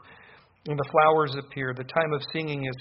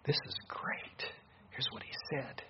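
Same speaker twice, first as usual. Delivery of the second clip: whispered, much softer aside; hushed aside; 12 dB quieter than usual. Real, not acted. Pastor hushing to the congregation before reading the verse. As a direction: lean in and hush it as a secret.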